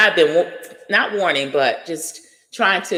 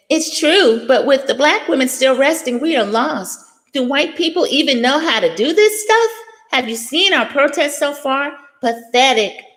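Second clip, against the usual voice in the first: high-pitched mock-cutesy read, imitating the writer; cutesy tweet voice; 9 semitones higher than usual. Vlogger reading a stranger's tweet to her chat, mock-cutesy. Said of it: "writing this little cutesy thing".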